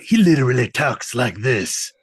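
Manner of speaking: put-on tough-guy voice; mock gruff action-star delivery; gruff action hero. Shown as a demonstration. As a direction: demonstrate the hero's gruff talk. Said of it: "talks like a gruff action hero".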